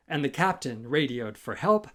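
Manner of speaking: ordinary delivery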